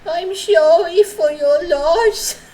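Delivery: put-on high, slow, babyish "I'm sorry for your loss"; mock-sad cutesy condolence. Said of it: "and be like".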